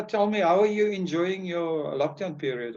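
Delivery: ordinary talk, nothing marked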